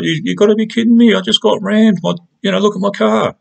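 high, incredulous squeal; you can't believe what you're hearing; outraged disbelief. Retold or imitated. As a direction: voice shooting up in shocked indignation. Disbelief at police refusing help.

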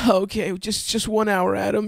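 high-pitched mock self-pep talk; sigh, then push through in a high pretend-crying voice; imitated weepy psyching up; mock tearful. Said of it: "I've seen you cry every day".

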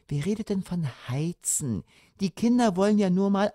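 high, soft wheedling; wheedling mother. A narrator's reading. Mother persuading father to light the fire, wheedling.

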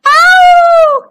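loud, high howl of "ow"; long high cry of pain. A narrator's reading. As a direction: yowl as if stung. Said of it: "howled little Wolf and Smells".

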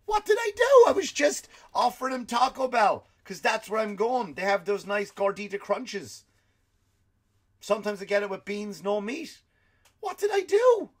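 high, loud squealing disbelief; mock panicked whine, way up high; bewildered panic. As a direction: shriek it like a baffled fired man. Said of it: "wondering, like".

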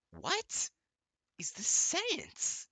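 high, slow squeak of disbelief; exasperated reader squeaking at a sentence; incredulous. Real, not acted. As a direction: pitch way up in disbelief. Baffled at bad writing.

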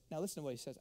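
ordinary delivery

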